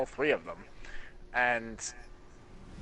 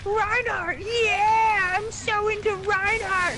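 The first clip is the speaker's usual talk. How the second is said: put-on very high squeaky kid voice, loud and excited; high-pitched squeaker fanboy; 6 dB louder than usual. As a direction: mimic a squeaky young gamer gushing. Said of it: "He's a squeaker".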